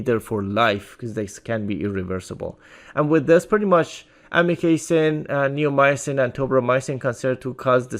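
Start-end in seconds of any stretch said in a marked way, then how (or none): none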